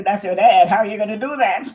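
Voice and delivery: loud, fast, laughing through it; laughing inner voice. Retold or imitated. Inner voice scolding her while she laughs, loud and fast. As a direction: blurt it out cracking up. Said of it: "I hear a voice say".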